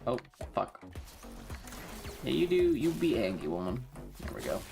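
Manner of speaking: ordinary talk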